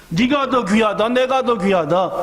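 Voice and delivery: normal talking voice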